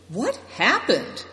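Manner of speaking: slow, high question; alarmed cry. A narrator's reading. Good Fortune questions the wounded soldier, crying out. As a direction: ask it urgently, stretching the words. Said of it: "cried Good Fortune".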